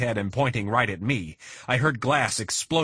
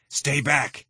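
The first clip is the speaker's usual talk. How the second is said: yelled warning; 6 semitones higher than usual. A narrator's reading. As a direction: shout them off. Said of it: "he yelled".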